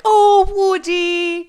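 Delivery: very high, loud, slow drawn-out cry; imitating Beth, high and loud, stretched out; doting gasp. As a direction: swoon over the name like a melting fan. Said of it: "she was just like".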